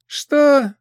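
high loud shout ahead; calling out from afar. Acted. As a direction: call out across the dark. Goblin calls from darkness.

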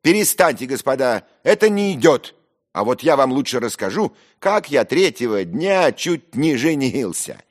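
high, loud, domineering host; shouting. Acted. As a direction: take over the table noisily. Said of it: "кричал Зверков".

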